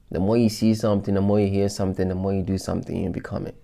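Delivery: ordinary delivery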